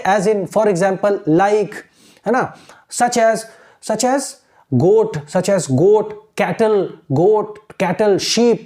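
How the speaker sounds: very slow, word by word recall; slow keyword recall. Shown as a demonstration. Teacher showing students how to recall the paragraph slowly. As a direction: draw each keyword out slowly. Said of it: "ये तीनों word use करो".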